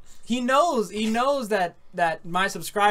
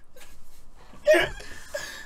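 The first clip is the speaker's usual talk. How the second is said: high laugh. Real, not acted. Laughing about sleeping David.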